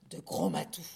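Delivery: sudden very low GROS MATOU; deep-voiced emphasis. A narrator's reading. Narrator naming the missing cat, deep-voiced emphasis. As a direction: drop the voice right down on the name.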